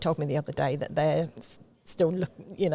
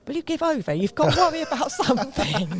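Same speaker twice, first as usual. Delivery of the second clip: high, exasperated teasing voice; 8 semitones higher, 4 dB louder than usual. Retold or imitated. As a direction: act fed-up with a laugh. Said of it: "the look on your face as if to say".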